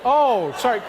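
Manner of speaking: normal talking voice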